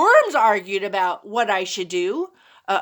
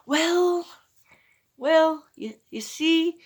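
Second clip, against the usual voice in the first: halting repeated words, higher; stuttering; 5 semitones higher, slower than usual. Acted. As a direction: stumble over the start like a nervous boy. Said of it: "Marco stuttered".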